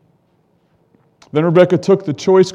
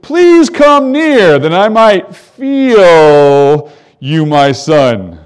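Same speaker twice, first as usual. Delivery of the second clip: put-on slow old father; 6 dB louder, much slower than usual. Blind Isaac asks to touch.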